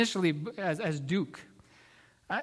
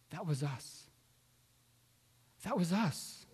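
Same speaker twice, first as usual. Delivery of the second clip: softly dawning recognition, retold; hushed realisation; 6 dB quieter than usual. Wife remembering the story is about her and her husband, hushed realisation. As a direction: quote it softly, as if it just came back.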